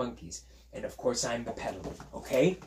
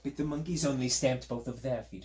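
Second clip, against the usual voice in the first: plain reading voice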